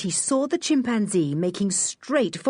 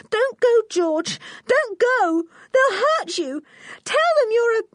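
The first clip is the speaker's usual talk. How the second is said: much higher, louder tearful begging; crying plea; 4 dB louder than usual. Acted. Anne begging George not to leave, crying high and loud. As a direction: beg her sister not to go, in tears. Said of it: "Anne began to cry".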